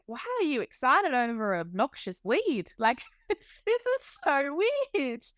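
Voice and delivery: high-pitched, amused disbelief; retold bafflement. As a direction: replay your bafflement at their excitement. Retells own bafflement at others' excitement.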